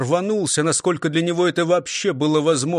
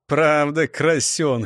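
smirking; slower than usual. A narrator's reading. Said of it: "хмыкнул Лихарь".

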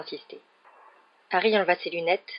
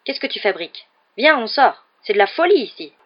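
urgent, raised voice over a crowd; crying out; 5 semitones higher, 7 dB louder than usual. A narrator's reading. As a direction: call to them, louder and higher. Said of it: "s'écria Monsieur Weasley". (s'écrier, pressant)

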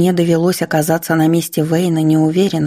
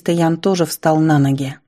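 ordinary delivery

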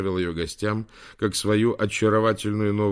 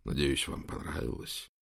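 low, hushed question; whisper; 6 semitones lower, 8 dB quieter than usual. A narrator's reading. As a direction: ask softly, as a discreet aside. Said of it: "спросил шёпотом". (негромко, почти шёпотом)

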